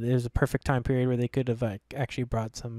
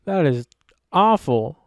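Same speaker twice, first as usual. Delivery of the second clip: loud, raised protest; emphatic, loud disapproval; playful outrage; 3 semitones higher, 8 dB louder, slower than usual. Real, not acted. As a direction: condemn the pun loudly. Playful dispute over punny title.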